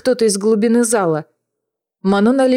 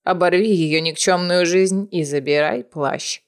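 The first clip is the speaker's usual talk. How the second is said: put-on a lower, softer old witch commanding; grandmother voice; 5 semitones lower, 3 dB quieter than usual. A narrator's reading. Grandmother orders the kill.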